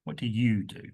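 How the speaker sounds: you stressed, falling tune; what do you do, weight on you. Shown as a demonstration. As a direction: lean on the word you. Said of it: "we're moving towards you".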